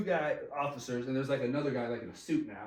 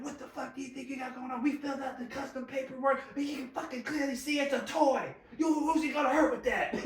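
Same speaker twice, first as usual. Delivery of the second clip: indignant teacher; 10 semitones higher than usual. Retold or imitated. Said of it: "they're like".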